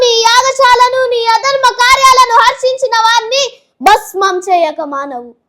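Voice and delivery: loud, high, raging mythological speech; furious stage monologue. Acted. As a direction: curse him in full theatrical fury. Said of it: "పాషాణ హృదయాది దక్షయజ్ఞం". (కోపంతో గట్టిగా నాటకీయంగా)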